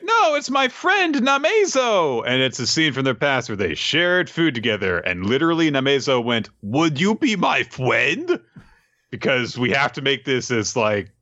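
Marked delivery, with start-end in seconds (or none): 0.0-2.2 very high, drawn-out mock plea; hammed-up pleading cry; acted protest. Kusanagi defends a little friend, high mock protest. 6.6-8.4 put-on high, slow baby-talk question; hammed-up childlike plea, rising at the end; cutesy little creature. Namezo asks Kusanagi to be friends, cutesy baby talk.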